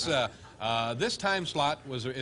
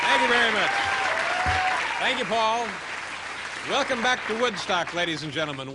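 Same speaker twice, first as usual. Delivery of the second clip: loud, high announcer welcome; TV host opener; 9 semitones higher, 6 dB louder than usual. Acted. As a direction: open the show like a host. Host's show opener.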